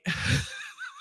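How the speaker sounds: a short, very high giggle; high laugh. Real, not acted. Host laughing at her own story, high giggle. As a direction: giggle at yourself.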